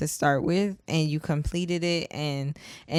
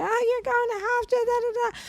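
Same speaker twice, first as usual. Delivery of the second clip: much higher, fast mocking singsong; 4 dB quieter, faster than usual. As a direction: mimic the gushing well-wishers, high and quick. Mocking praise at graduation.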